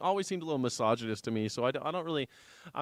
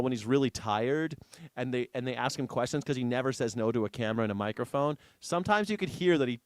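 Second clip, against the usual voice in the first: normal talking voice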